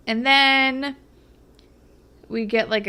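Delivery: usual speaking voice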